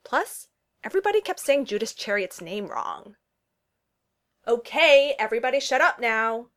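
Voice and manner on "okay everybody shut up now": shouting. Acted. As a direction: call out over the argument. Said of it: "Jake shouted".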